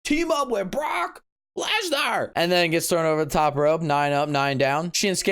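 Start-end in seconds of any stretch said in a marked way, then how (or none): 0.0-2.3 imitating Heyman. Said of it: "Paul Heyman's like".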